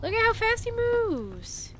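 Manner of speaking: squeaky amazed exclamation; amazed high voice. Real, not acted. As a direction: pitch way up in disbelief. Amazed at dog speed.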